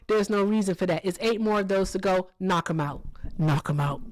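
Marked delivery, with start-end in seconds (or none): 2.4-4.0 a hint of Hector barking orders; Hector impression. As Hector urging viewers on, lower and bossy. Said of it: "'cause Hector said so".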